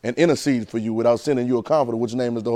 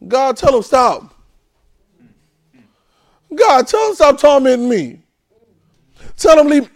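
high, loud, slow begging; tormented minister pleading; desperate plea; 11 semitones higher, 9 dB louder, slower than usual. Acted. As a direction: cry out to God to make him stop.

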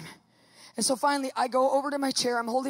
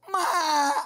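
tearful imitated wail; hammed-up crying protest; mock wail; 4 semitones higher than usual. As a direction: whine like a child refusing medicine. [name] protests the pill.

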